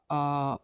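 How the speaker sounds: stretched long aa sound; drawn-out aa. Shown as a demonstration. Teacher showing pupils a stretched आ. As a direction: hold the आ long and open. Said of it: "यानी खींच कर पढ़ना होगा".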